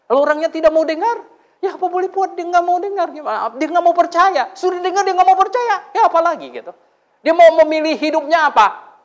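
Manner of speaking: high, loud, exasperated preaching; heated preaching. Acted. Preacher venting about people who refuse to listen, high and heated. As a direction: push it up, fired up and exasperated.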